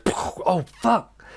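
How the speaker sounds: a breathy oh, then a high sharp curse; startled curse. Retold or imitated. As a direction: jolt as the gun goes off. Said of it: "he goes".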